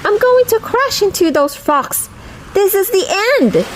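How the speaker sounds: high-pitched storybook panic; alarmed cry. A narrator's reading. Little wave about to crash into rocks, alarmed cry. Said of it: "he cried".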